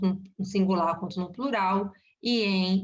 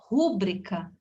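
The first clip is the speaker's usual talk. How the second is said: "rúbrica" with the first syllable RÚ stressed; slower than usual. Shown as a demonstration. Teacher showing the common mistake, "rúbrica" stressed on first syllable RÚ. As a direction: punch RÚ at the start of "rúbrica". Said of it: "se a gente fala errado".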